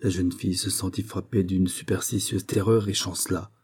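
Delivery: ordinary delivery, nothing marked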